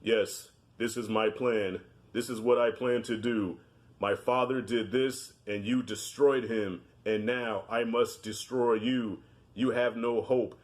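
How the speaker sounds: wooden, slow, low villain delivery; bad acting. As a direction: read it stiff and bland like a bored villain. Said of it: "he was talking like".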